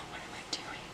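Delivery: very soft whisper of disbelief; stunned whisper. Real, not acted. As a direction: whisper to yourself, barely audible. Stunned at cutting own hair.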